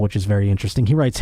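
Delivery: usual speaking voice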